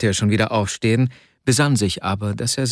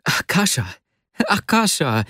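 higher-pitched repeated cry of a name; calling out; 7 semitones higher than usual. A narrator's reading. Vasja jumping up, calling out to Akasha excitedly. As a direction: call your friend's name with feeling. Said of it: "rief Vasja".

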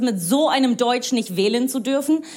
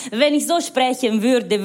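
German with a put-on Italian accent; slow, lilting Italian-accented German. Shown as a demonstration. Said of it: "Weil ich akzentfrei Deutsch spreche".

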